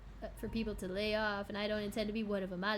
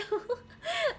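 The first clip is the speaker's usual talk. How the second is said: high-pitched burst of laughter; short, high real laugh; amused laugh; 4 dB louder than usual. Real, not acted. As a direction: crack up after the outburst. Laughs after voiced outburst.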